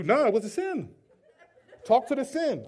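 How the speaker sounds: loud, high mock indignation; indignant denial. Sinner sending accuser to talk to sin, indignant denial. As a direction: bat the blame away, hammed up and loud. Acts out sinner refusing blame when challenged.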